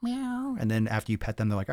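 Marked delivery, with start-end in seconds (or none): 0.0-0.6 much higher, squeaky mock meow; cat meow. Imitating a cat answering his call, high mock meow.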